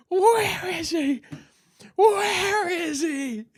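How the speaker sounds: high, slow, stretched-out demand; imitated enraged mom. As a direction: drag out each word like a menacing mother. Retold enraged mom at the door.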